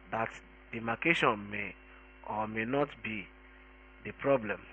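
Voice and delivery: usual speaking voice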